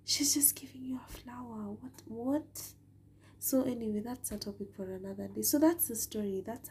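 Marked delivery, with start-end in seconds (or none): 0.1-2.7 incredulous whisper to herself; whispered disbelief. Retold or imitated. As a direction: whisper it like a private reaction. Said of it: "And I just thought".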